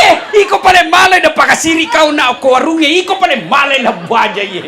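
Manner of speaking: loud, laughing mock scold; hammed-up nagging wife. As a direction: shout at the husband half in jest. Wife scolding husband, acted.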